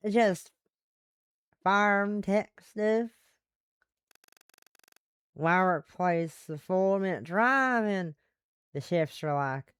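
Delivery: slow Southern drawl; Southern woman. Acted. Telling a new acquaintance about farm work, in a Southern accent. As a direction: stretch it out in a Western Southern accent. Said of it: "one female Western Southern voice".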